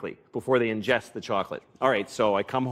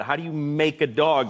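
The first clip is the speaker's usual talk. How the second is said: normal talking voice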